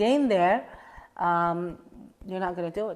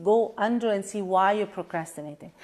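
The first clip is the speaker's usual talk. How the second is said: normal talking voice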